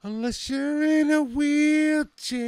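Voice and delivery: slow, high put-on voice; a slow, goofy falsetto echo; mock voice. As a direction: stretch it out in a silly high voice. Echoing the quoted line in a put-on voice.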